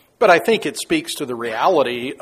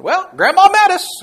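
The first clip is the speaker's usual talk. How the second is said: acted; 10 dB louder than usual. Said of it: "you just calmly say".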